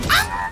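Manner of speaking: high sudden yelp of pain; startled scream. Real, not acted. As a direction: shriek as the knife hits. Stabbed in game.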